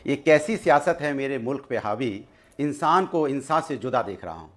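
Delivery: low, slow couplet delivery; poetry recitation. Acted. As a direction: recite the verse slowly and deep in pitch. Recites a verse mid-debate. (धीमे, नीचे सुर में शेर पढ़ना)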